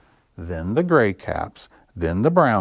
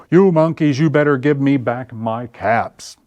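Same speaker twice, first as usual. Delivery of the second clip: higher, louder stern warning; angry warning; 4 semitones higher, 5 dB louder than usual. Acted. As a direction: stamp your foot and warn them. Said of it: "Now he felt quite angry".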